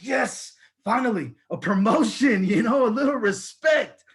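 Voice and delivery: high, triumphant outburst; elated. Acted. Employee expecting a promotion from his supervisor, elated. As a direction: celebrate as if finally vindicated.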